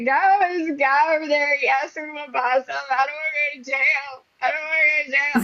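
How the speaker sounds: high-pitched, mock-panicked pleading; retold panic. Daughter running to mom fearing jail, hammed-up panic. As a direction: imitate a panicky whine to mom. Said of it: "'cause I thought they were gonna come get me".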